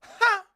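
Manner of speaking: sudden high, loud single "ha"; one loud, high barked laugh; mock laugh. Woman imitating the guy who laughed in the movie, mock laugh. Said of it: "he would just go".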